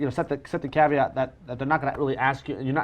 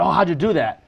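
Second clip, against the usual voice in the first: mock amazed spectator; 4 semitones higher, 6 dB louder than usual. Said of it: "and they went".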